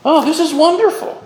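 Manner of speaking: high, loud, gushing fake delight; mock delighted cry. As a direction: cry it out in exaggerated, high-pitched wonder. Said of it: "And he cried out".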